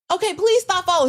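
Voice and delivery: high, loud urgent plea; pleading to stop. Retold or imitated. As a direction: beg him firmly to back off. Friend pleading with a follower.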